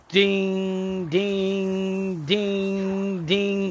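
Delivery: imitated ringing dings. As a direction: ring out each ding like a bell. Imitating repeated single-shot trigger presses.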